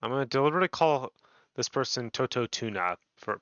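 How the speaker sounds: ordinary talk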